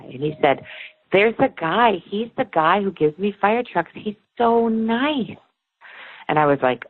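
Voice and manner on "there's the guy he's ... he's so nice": put-on higher, small sweet toddler voice, slowing on the last words; child voice. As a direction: lightly play the little boy pointing at grandpa. Two-year-old son describing grandpa.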